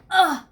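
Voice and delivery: loud high pained ugh; ugh groan. Acted. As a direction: groan like you fell. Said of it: "Remember that sound?".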